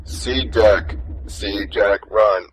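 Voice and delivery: low, halting robot reading. Acted. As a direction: read each word like a slow machine. Robot reads a primer aloud.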